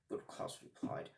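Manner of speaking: ordinary delivery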